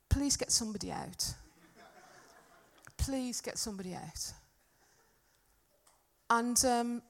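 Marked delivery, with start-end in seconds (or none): 0.1-4.3 soft, hushed repeated plea; inner pleading. Retold or imitated. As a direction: beg under the breath, fading on the repeat. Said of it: "inside of me, I'm still going".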